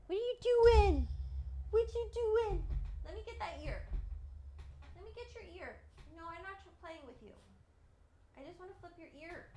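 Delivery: sweet high pet-talk voice. Real, not acted. Baby talk to her dog.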